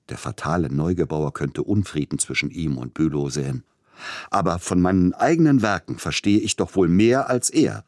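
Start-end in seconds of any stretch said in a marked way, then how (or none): 4.3-7.8 higher, louder, pointed self-assertion; sharp emphasis. A narrator's reading. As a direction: stake your authority with hard stresses. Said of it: "sprach hastig und mit scharfer Betonung".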